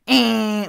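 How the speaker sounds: very high, very loud horn blast; air horn imitation. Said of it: "with the air horn".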